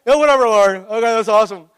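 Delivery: loud, offhand brush-off; loud mock teenage indifference; dismissive teen. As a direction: shrug it off like a bored fifteen-year-old. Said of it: "as a fifteen-year-old, you're like".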